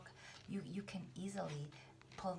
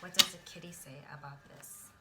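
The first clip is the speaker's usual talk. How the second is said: unvoiced whispered question; whispering; 9 dB louder than usual. Real, not acted. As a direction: whisper it to the cards. Whispered take then plain repeat.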